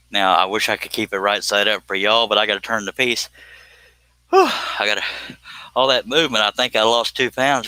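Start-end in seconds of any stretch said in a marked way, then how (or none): 4.3-5.0 out of breath. Real, not acted. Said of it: "I'm out of breath".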